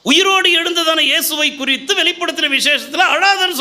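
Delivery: high, loud triumphant preaching; fiery sermon over music; preaching at full force. Acted. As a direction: challenge them: why are you still crying.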